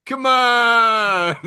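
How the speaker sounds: laughing exasperation. Real, not acted. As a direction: come on, loud and high through laughter.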